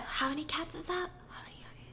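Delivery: anxious whispered counting; frantic whisper. Retold or imitated. Tripping guy recounting cats, in an anxious whisper. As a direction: whisper it as you lose count.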